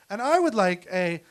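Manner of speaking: wife voice. Retold or imitated. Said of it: "and then she was like".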